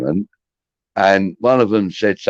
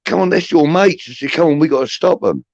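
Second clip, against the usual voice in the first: acted urgent appeal, pitched up; rally her in a raised, higher voice; urgent urging; 9 semitones higher than usual. Telling her to stop her mates fighting, urgent.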